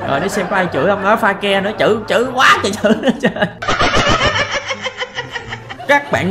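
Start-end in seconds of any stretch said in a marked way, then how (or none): 3.4-5.8 a sudden high burst of laughter; squealing laugh, caught off guard. Real, not acted. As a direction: crack up at the joke name. Laughs at the silly name.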